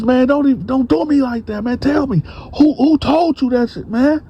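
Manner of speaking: very high, frantic voice, pitch way up; hooting and hollering. Retold or imitated. Bone demands a name from Dante, shrill and frantic. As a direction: overact outrage to cover guilt. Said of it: "just putting on a whole show".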